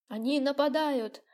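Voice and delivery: alarmed high shout. A narrator's reading. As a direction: cry out a warning. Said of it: "раздался вдруг крик Вовки".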